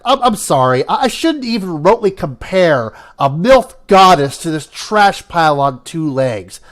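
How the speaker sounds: ordinary talk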